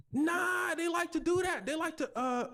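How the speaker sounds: high, stammering denial; flustered excuse. Retold or imitated. Abusive mother caught by police, high flustered excuse.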